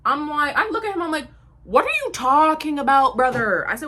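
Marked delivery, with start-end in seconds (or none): 1.6-3.7 loud, high, exasperated question; exasperated challenge. Retold or imitated. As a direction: argue back loudly in disbelief. Arguing back in retold quarrel.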